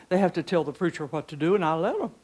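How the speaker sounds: normal talking voice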